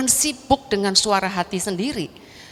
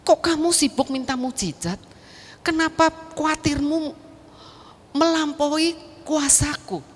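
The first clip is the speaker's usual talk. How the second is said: higher-pitched retold divine reproach; voice of God; 7 semitones higher than usual. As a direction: voice God questioning a worrier, pitched up. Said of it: "handphone saya yang tidak punya channel kepada Allah". (menirukan teguran Tuhan dengan nada lebih tinggi)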